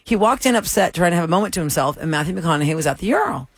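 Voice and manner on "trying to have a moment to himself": usual speaking voice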